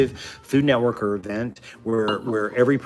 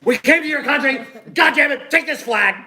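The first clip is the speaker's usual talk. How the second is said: much higher, louder furious explorer; hammed-up conqueror planting a flag; angry agronaut; 5 dB louder than usual. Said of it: "It's like".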